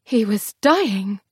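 normal talking voice

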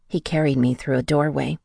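ordinary delivery

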